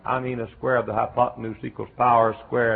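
ordinary talk

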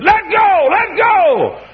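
high shouted command; mock shout. Church lady at the altar yelling at the sinner, shouting. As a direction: bellow it like an altar worker. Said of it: "hit me on the back and said".

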